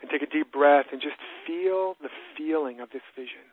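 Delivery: calm, unhurried, with long pauses for breath; guided meditation. Shown as a demonstration. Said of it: "I'll take 'em through a short version".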